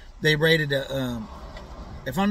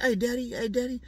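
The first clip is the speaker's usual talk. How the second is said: higher, teasing hey daddy call; mock fan voice; 7 semitones higher, 4 dB quieter than usual. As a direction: lightly mimic a clingy New York guy. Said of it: "He's like".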